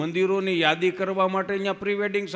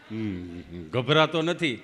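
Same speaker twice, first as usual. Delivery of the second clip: lower, halting stammering friend; Gabho, tongue-tied; 8 semitones lower, slower than usual. Retold or imitated. Friend answering the nurse at vaccination, lower halting stammer. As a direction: drag the words out like a tongue stuck in place. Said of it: "જીભ જલાય સોંટે અટકે".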